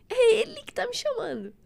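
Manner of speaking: choked-up admission, close to crying; tearful. Real, not acted. Telling the pastor about God's call, voice breaking with tears.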